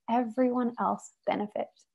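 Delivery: usual speaking voice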